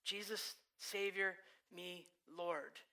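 soft, hushed plea to Jesus; quiet desperate plea. Retold or imitated. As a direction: pray it almost under your breath. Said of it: "it's this pattern".

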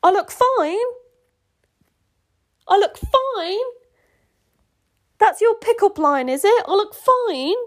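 high, loud, incredulous echo; mocking disbelief. Real, not acted. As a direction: repeat it back as if it's absurd. Reader mocks the pickup line.

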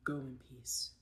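soft unvoiced whisper; a hushed go in peace; whispered blessing. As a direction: breathe it out gently. Quoting Jesus softly.

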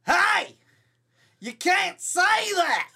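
loud high holler, slowly stretched out. Shown as a demonstration. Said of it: "let me try it again".

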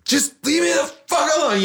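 high-pitched mock shout; retold angry outburst. Teen to his dad after shoving him, high retold outburst.

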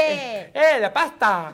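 loud, slow hammed-up Argentine drawl; mock Argentine accent. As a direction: stretch it out in a fake Argentine accent. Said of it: "Cómo hablan los argentinos".

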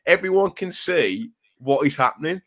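mock screaming at telly. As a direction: imitate exasperated yelling at the TV. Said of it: "screaming at the telly".

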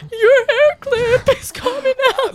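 very high, slow, drawn-out mimicry; high-pitched mock warning from a fellow rider; imitated rider's warning. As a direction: stretch it out in a high put-on voice. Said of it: "He's like".

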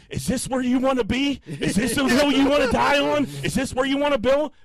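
high, loud strained yelling; retold screaming. Caller at work screaming at billing rep, retold. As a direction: re-enact screaming down the phone. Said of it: "I fucking screamed at one point".

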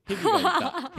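high, loud, laughing; breaking up while giving dialect examples. Real, not acted. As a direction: burst out laughing on it.

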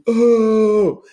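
long, high, loud "oh"; crowd jeer. Retold or imitated. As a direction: imitate everyone heckling at once. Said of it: "everyone was like".

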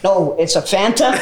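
slow, put-on American "Fanta"; exaggerated American pronunciation, spelled out slowly; American accent. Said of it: "what you said, had to go like".

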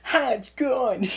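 very high, loud, drawn-out squeal; high-pitched playful outburst. Real, not acted. Playful reaction to hair remark.

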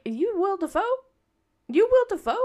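high, puzzled double take; a puzzled fan echoing the odd speech; confused disbelief. Retold or imitated. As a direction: repeat it baffled.

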